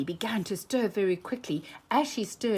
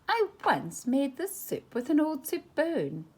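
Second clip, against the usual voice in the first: higher, sing-song coaxing; sly baiting; 8 semitones higher than usual. A narrator's reading. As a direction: tease the miser into giving. Daisy baiting uncle.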